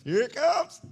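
high, loud call rising at the end; sudden loud warning. Real, not acted. Repeats the warning, suddenly raised.

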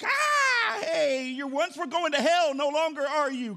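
very high, loud ecstatic shout; overjoyed. Pretend. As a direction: leap with joy as you say it. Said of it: "act like you're excited about it".

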